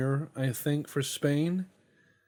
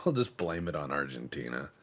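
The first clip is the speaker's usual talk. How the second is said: ordinary talk, nothing marked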